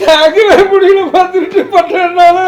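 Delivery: high, loud, drawn-out wail; loud crying. Acted. Uncle to niece, hurt by theft accusation, wailing. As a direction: bawl it out slowly, unable to bear it. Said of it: "ஏன் இந்த மாதிரி அழுதுட்டு இருக்கீங்க".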